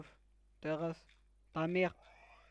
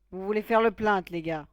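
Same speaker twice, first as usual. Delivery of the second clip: a loud put-on voice; hammed-up mocking yell about the complaint; mock loud taunt; 2 semitones higher, 13 dB louder than usual. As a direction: taunt the viewers loudly. Mocking the word plainte.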